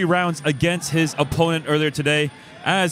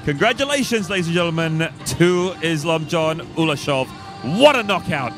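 excited announcement; 3 semitones higher than usual. Real, not acted. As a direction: proclaim the winner to the crowd. Commentator congratulates the knockout winner.

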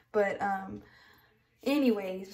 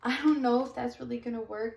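ordinary talk, nothing marked

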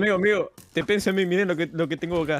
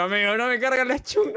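higher, louder frustrated whine; frustrated complaint; 11 semitones higher, 4 dB louder than usual. Real, not acted. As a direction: complain the weapon won't load. Weapon won't load, frustrated. (queja aguda y frustrada)

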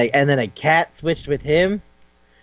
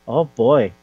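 ordinary delivery

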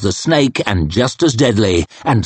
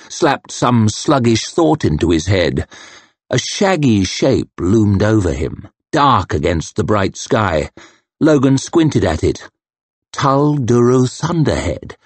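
usual speaking voice

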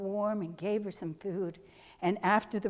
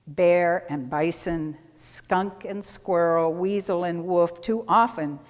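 measured, louder, ceremonial reading; solemn blessing for the animals; formal reading; 3 semitones lower, 4 dB louder than usual. Acted. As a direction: read it like a liturgy. Reads a blessing to congregation.

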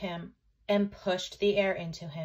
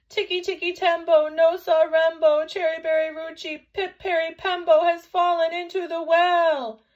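put-on high, loud child's shout; panted shout; 8 semitones higher, 10 dB louder than usual. A narrator's reading. Chang shouting his brother's long name to mother, panted shout. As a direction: shout the whole name in one big breath. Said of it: "he panted".

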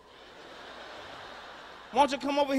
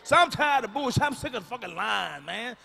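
usual speaking voice

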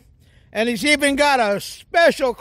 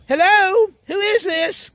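psychic's voice; 9 semitones higher than usual. Retold or imitated. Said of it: "and she said".

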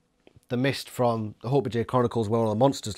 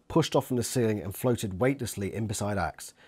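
usual speaking voice

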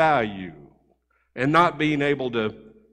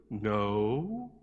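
put-on small, hushed child's hello; a timid kid picking up the phone, hushed; quiet little boy; 6 semitones lower, 10 dB quieter than usual. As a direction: answer softly like a shy little boy. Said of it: "real quiet and soft".

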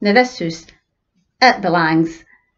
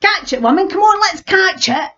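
eager urging; 10 semitones higher than usual. Acted. Weaver urging wife to chase.

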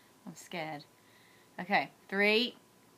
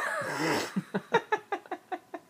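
high laughing after the pain; pained laughter; 12 semitones higher than usual. Real, not acted. Wife laughs off the pain with her husband, high laughter. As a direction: laugh through the hurt.

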